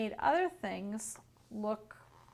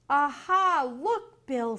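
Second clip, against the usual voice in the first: loud, higher "aha"; excited discovery; 4 semitones higher, 7 dB louder, slower than usual. A narrator's reading. Pete alerting Bill to what he spotted, excited. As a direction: call out a sudden discovery. Pete spots the bad guys.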